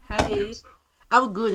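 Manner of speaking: ordinary talk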